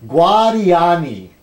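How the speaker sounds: slow, drawn-out Spanish accent on Guadiani. Shown as a demonstration. Retrying the mangled name in a Spanish accent. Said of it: "excuse my Spanish-speaking friends if I mangled this".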